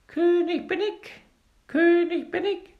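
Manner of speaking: very high, loud little bird voice; fine piercing voice. A narrator's reading. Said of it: "mit feiner, durchdringender Stimme".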